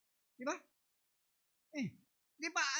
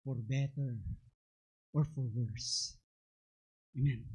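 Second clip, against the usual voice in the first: wedding vow; 10 semitones lower, slower than usual. Acted. Recalling wedding vows, slowed.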